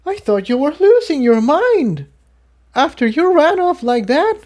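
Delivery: put-on very high, hurt young mare; upset mare voice. A narrator's reading. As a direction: let the hurt spill out, then fade. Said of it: "She trailed off".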